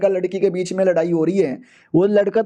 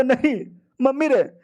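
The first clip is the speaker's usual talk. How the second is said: whiny disbelief; 5 semitones higher than usual. Acted. Rival in disbelief.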